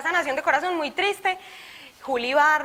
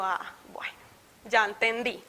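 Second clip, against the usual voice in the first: resigned whisper; 3 semitones lower, 6 dB quieter than usual. Retold or imitated. Reacción resignada en la clase.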